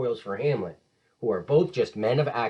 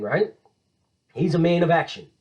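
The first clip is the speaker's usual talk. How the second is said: ordinary delivery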